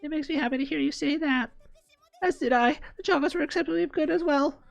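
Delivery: put-on high, polite girlish voice; lightly voiced schoolgirl. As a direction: voice the sweet classmate. High girl character voices.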